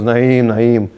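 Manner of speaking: drawn-out, unconvinced mock wail; half-hearted pretend cry. Robber feigning grief for an imagined son, half-hearted cry. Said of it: "I'm gonna cry over Naeem".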